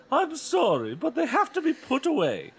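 high, loud mock-mom; mother insisting firmly; hammed-up firm mom. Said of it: "Missus Powell said firmly".

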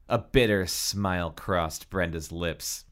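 ordinary delivery

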